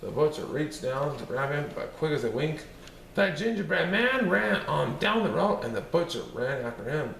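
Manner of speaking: ordinary delivery, nothing marked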